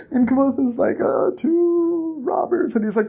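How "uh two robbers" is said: slow, high, drawn-out reply; acted hurt hesitation; reluctant answer. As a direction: drag it out feebly. Hurt Kvothe answers frantic Bast.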